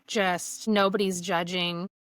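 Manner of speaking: usual speaking voice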